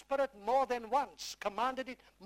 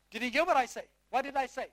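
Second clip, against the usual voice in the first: usual speaking voice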